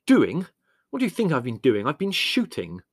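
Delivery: irritated sarcasm; exasperated retort. A narrator's reading. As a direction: snap back at a silly question. Muddy son retorts to mother.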